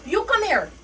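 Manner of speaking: sharp loud summons, high and forceful; snapping order. Retold or imitated. Supervisor snapping at the cashier, loud and sharp. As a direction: bark it like a boss calling you over. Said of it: "Not you snapping on me".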